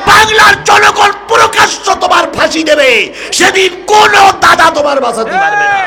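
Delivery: high, loud sustained shouted chant; full-force preaching. Acted. Preacher rousing the crowd about party loyalty, full-force shout. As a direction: thunder it out on one high note.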